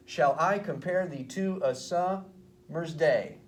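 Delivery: slow scansion, leaning on the "I" in "Shall I" and "day"; metrical stress. Shown as a demonstration. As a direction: beat out "Shall I compare thee" foot by foot. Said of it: "accent is on the second".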